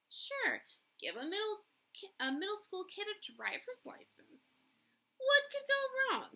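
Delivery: high mocking sarcasm, rising to a loud jab; biting mock disbelief; sarcastic. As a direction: scoff at the idea, pitch up high. Sarcastic rant at kid's parking.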